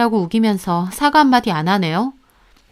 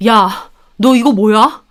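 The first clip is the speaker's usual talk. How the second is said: stern confrontation; 6 dB louder, slower than usual. Acted. Husband confronting sister with phone.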